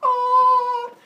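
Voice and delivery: high, loud drawn-out scream; hammed-up wail of being destroyed; mock scream. Human sees a god's true form, mock screaming.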